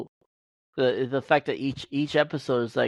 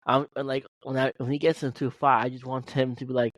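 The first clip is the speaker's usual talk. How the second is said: ordinary talk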